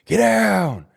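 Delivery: loud, high-pitched barked order; forceful re-enacted yell; commanding shout. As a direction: shout it to a whole gym. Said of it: "I get on the fucking P A system".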